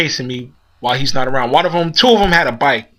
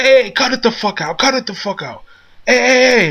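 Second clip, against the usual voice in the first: mock shout to break up a fight, higher; store owner yelling; 8 semitones higher than usual. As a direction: bark it like a shop owner stopping a brawl.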